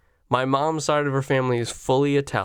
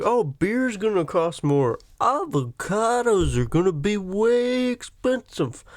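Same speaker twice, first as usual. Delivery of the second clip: mocking whiners; 6 semitones higher than usual. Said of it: "everyone was like".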